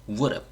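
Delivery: "would have" said as "wour-abi"; fast linked pronunciation. Shown as a demonstration. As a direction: slur "would have" into one word without the h. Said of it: "이렇게 되니까". ("would have"를 "워럽"처럼 붙여서)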